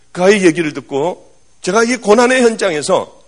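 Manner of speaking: ordinary talk, nothing marked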